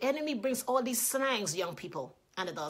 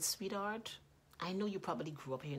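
discreet correction; 7 dB quieter than usual. Retold or imitated. Mother takes the woman aside about her son, quiet correction. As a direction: correct someone quietly and privately.

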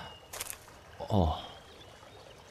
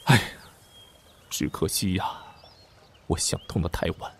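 ordinary talk, nothing marked

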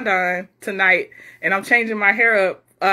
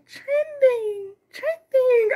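much higher, imitating a small child; cute little girl voice; much slower than usual. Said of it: "her voice is so cute".

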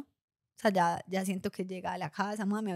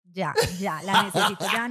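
louder, pushing hard, half laughing; insistent demand; 6 dB louder than usual. Retold or imitated. Daughter demanding a photo from her mother, insistent.

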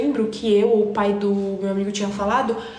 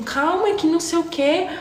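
pleading calm down; 8 semitones higher than usual. Retold or imitated. Narrator pleading with angry girl.

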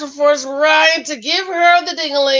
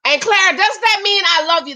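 sarcastic question; 9 semitones higher, 4 dB louder than usual. Real, not acted. Mocking question to Claire.

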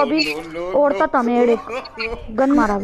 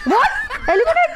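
very high, laughing squeal of surprise; amazed disbelief; 11 semitones higher, much slower than usual. Real, not acted. Gamer astonished by an elimination, high incredulous cry.

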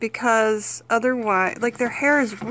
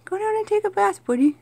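coaxing pet voice; 8 semitones higher than usual. Real, not acted. Urging chinchilla into bath.

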